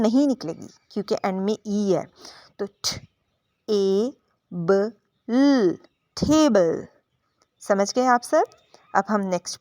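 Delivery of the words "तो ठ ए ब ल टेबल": ठ, ए, ब, ल, then टेबल blended; sounding out table. Shown as a demonstration. Teacher sounding out table for the class. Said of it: "टेबल को भी हम पढ़ेंगे".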